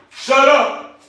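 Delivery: loud high bark of shut up; hollering. Real, not acted. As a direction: holler it to shake them up. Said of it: "I gotta do some hollering at some of these dogs".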